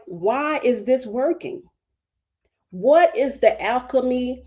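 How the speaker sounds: ordinary talk, nothing marked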